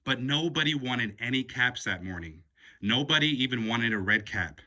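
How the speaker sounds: plain reading voice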